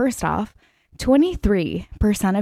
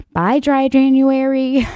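mock goodbye; 7 semitones higher, 4 dB louder, slower than usual. Mock farewell to resolutions.

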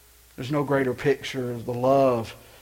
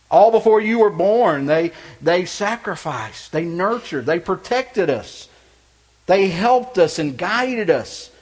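loud, high impassioned preaching; 9 semitones higher, 8 dB louder than usual. Acted. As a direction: build it up into a fiery sermon. Preaching at full force.

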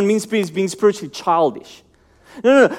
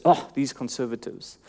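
much softer and lower, a dismissive groan; scornful sigh; 8 semitones lower, 10 dB quieter than usual. Retold or imitated. Mocking how we talk about conservatives, softly scornful. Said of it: "we speak about the conservatives".

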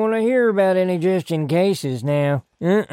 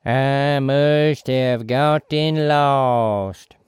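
slow, eerie, possessed voice; possessed monotone; slower than usual. Acted. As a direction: drone it like a hypnotized man. Possessed Bobby Ray, slow.

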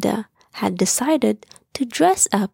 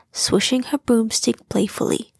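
ordinary delivery

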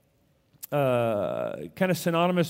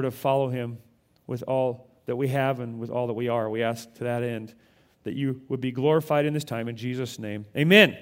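prayer; 4 semitones lower than usual. Acted. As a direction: pray gently over the reading. Opening prayer.

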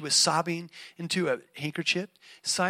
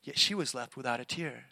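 ordinary delivery

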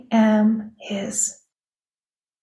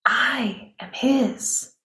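tender; 2 semitones higher, 3 dB quieter, much slower than usual. Real, not acted. Quiet tender declaration.

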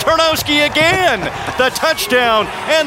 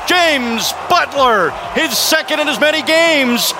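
high, shouting touchdown call; 6 semitones higher than usual. Real, not acted. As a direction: yell the score as it happens. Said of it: "touchdown, Tiger Cats!".

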